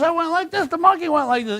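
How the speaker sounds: high, loud joke-character complaint; worked-up mock explanation; acted indignant protest. Acted injured man protesting in joke.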